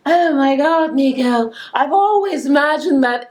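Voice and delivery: swooning romance heroine. Pretend.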